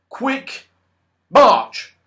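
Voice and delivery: high, sharp loud command; wizard's order. A narrator's reading. Wizard ordering the sulky goblins off, loud command. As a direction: bark it at the goblins. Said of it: "said the wizard".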